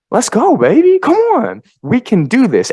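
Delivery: high, loud hype; fired-up rallying. Real, not acted. Host rallying listeners to take action, fired-up.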